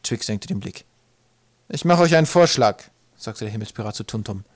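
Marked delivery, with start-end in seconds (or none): 1.7-2.8 high, loud sky-pirate voice; bold pirate offer. A narrator's reading. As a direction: hail them with a loud, raised-voice proposal.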